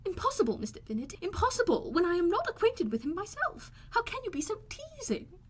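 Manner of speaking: high, exasperated protest; protesting Mrs Bennet. A narrator's reading. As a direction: throw up your hands, pitched high.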